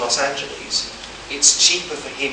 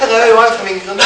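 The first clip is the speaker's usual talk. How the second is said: high, loud, laughing prim Englishman; 10 semitones higher, 14 dB louder than usual. Retold or imitated. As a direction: squeak it out through laughter. Mocking his own English politeness.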